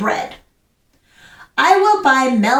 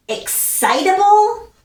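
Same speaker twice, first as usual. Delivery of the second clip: higher, rising excited lilt; excitable; 6 semitones higher than usual. Shown as a demonstration. Teacher reviewing exclamation points with class, excitable. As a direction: lift the word up with excitement.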